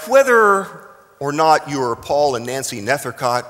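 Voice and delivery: ordinary talk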